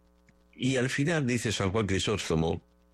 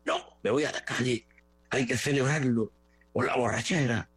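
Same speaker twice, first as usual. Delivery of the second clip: higher, jeering voice of a fan heading out to party; mock celebrating fan; 5 semitones higher than usual. Preacher mocking a football fan off to celebrate. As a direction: play the reveller who won't pray.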